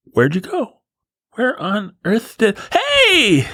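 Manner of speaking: put-on very high, wide-eyed baby, amazed then whimpering; squeaky baby losing and finding its parent at peekaboo. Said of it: "Babies are morons".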